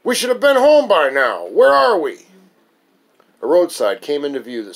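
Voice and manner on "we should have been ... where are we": high, loud panicked yell; loud, high-pitched shout of alarm; drunk yelling. Retold or imitated. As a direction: yell it out, jolted awake and lost. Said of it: "Dan jumped from his seat yelling".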